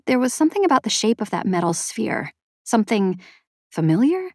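plain reading voice

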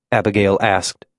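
plain reading voice

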